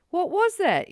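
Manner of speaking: high and loud, disbelieving; mock outrage at being ignored; incredulous. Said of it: "And it was like".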